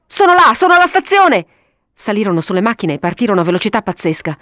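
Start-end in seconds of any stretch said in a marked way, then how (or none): 0.1-1.5 very high, loud cry; excited shouted alarm; urgent yell. A narrator's reading. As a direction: call it out at the top of the voice. Jacques yelling urgently to the others.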